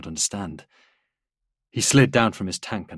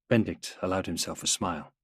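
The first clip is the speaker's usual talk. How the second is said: usual speaking voice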